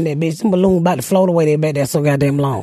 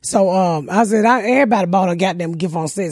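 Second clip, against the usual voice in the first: ordinary delivery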